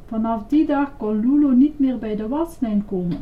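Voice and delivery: usual speaking voice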